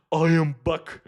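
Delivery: loud, badly faked Austrian action-robot voice; first botched go at a famous cyborg voice, punched out; Arnold Schwarzenegger's Terminator. Streamer reading a chat comment aloud as the Terminator, loud bad impression. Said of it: "read that out in Arnold Schwarzenegger's Terminator voice".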